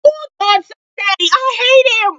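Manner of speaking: ordinary talk, nothing marked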